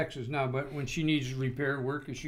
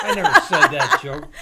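high, loud, laughing denial; laughing protest; 12 dB louder than usual. Real, not acted. Friend laughing and protesting loudly at being teased. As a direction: burst out laughing as you deny it.

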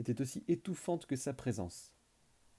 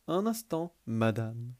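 madame stretched and leaned on mockingly; stress on madame; 4 dB louder, slower than usual. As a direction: drag out madame with a sneer. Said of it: "accentuer ce mot". (madame appuyé et traîné)

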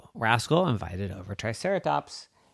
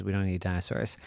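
plain reading voice

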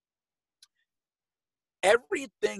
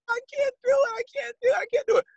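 high, whiny mock sobbing plea; imitating crying; faster than usual. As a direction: squeal it like a lifter breaking down.

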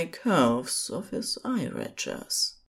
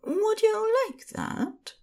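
high, coaxing question; flirting angel voice. Acted. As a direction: tempt him gently, high and sweet. Flirting angel asks demon.

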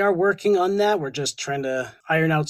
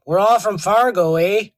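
mock Canadian accent; 3 semitones higher, 7 dB louder than usual. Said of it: "stereotype of Canadians".

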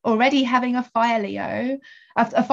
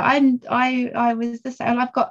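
ordinary talk

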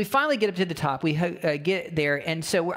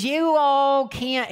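higher, louder, slowed-down imitated yell; mock angry yell; 9 semitones higher, 5 dB louder, much slower than usual. Hiker ranting at the couple about their dog, mock yell. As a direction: act out the angry hiker's outburst. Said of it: "He's yelling at them".

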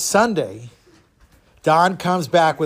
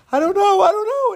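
very high, whiny mock wail; hammed-up tearful squeal; crying; faster than usual. Second sister cannot answer the riddle, crying. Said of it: "she also ran in crying".

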